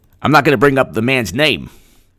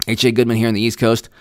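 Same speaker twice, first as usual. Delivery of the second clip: ordinary delivery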